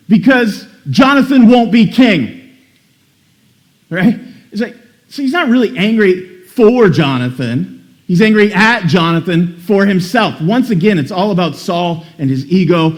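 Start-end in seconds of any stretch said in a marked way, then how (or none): none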